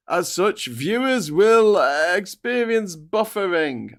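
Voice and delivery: high, drawn-out reading of a warning; theatrical error-message readout; mock announcement. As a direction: stretch the words out high and loud.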